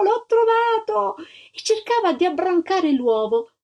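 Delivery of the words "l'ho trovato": high cry, slow and drawn out; joyful shout. A narrator's reading. Little girl repeating her find to the crowd, slow joyful cry. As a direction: stretch out the cry, overjoyed. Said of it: "si mise a gridare".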